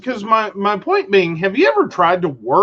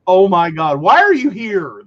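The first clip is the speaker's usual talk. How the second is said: incredulous; 4 dB louder than usual. Retold or imitated. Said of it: "That was my next question".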